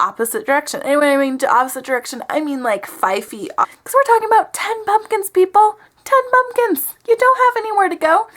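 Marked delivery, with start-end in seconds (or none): 3.8-8.3 high, loud, incredulous outburst; mock exasperation. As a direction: exclaim it in hammed-up disbelief, much higher. Mock outburst about tiny pumpkin patch.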